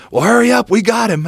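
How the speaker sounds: high, loud urging call; urgent shout. Retold or imitated. Friend urging him on phone.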